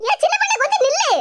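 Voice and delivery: high call after someone walking off. Acted. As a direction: shout her back before she leaves. Calling after leaving woman.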